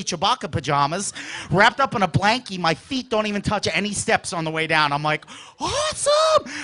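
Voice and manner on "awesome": high, drawn-out squeal of delight; excited cry. Retold or imitated. Kid racing down to Saturday cartoons, excited cry. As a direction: stretch it out high in kid glee.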